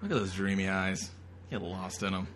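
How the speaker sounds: normal talking voice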